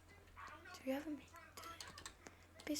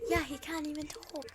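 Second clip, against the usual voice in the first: ordinary delivery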